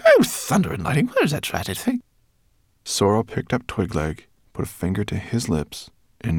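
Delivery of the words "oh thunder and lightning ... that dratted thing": grumbling rat voice. Acted. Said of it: "she muttered".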